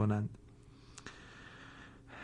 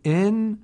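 ان said much higher and louder, the doubled sound held; doubled letter held; 8 semitones higher, 6 dB louder than usual. Shown as a demonstration. Teacher reciting a Quran example to students, loud and held.

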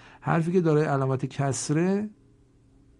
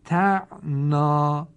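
slow and louder chanted recitation; Quranic recitation; 5 dB louder, slower than usual. Shown as a demonstration. Teacher reciting Quran examples for students, slow and chanted. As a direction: chant the words slowly with held doubled letters. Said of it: "تفکیک ها رو خودتون بخوانید".

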